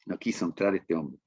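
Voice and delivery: normal talking voice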